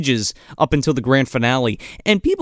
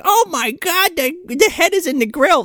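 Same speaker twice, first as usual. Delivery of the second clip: higher mock horrified girl; lightly voiced girl finding the head; girl's mock alarm at the grill; mock shocked girl; 12 semitones higher than usual. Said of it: "that girl's like".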